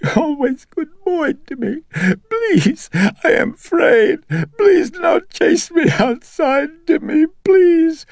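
high, loud, tearful pleading; frightened and tearful. A narrator's reading. Mother begging her son not to cast her out, sobbing. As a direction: cry out to him, terrified of the dark. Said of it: "grew frightened and tearful".

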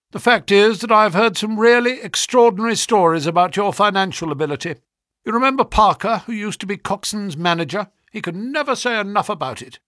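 high, loud, effusive businessman; brisk flattering agent. A narrator's reading. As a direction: flatter him with hearty conviction. Voices brisk visitor.

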